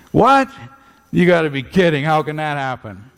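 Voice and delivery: high mocking squeal of disbelief on What. Nicodemus scoffing at Jesus, high mocking disbelief. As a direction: scoff as if it's absurd. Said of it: "was not being very respectful".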